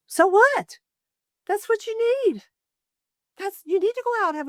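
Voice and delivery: a high, forceful so what; emphatic rebuttal. Real, not acted. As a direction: brush the guilt aside with a bright, high push.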